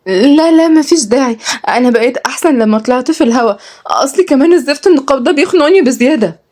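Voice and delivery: high, animated heroine voice; lively young woman. Acted. Young woman insists she feels fine, high and animated. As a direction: lift the pitch well up, brisk reassurance.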